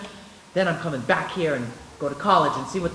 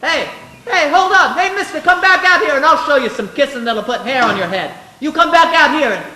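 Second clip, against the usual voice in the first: much higher, louder call after him; yelling back; 8 semitones higher, 8 dB louder than usual. Acted. George calls out to the old man, cocky shout. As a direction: holler a cocky challenge.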